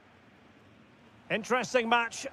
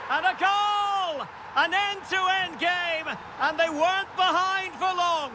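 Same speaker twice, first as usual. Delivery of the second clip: high, drawn-out goal shout; excited goal call; 9 semitones higher than usual. Real, not acted. Commentator calls a goal.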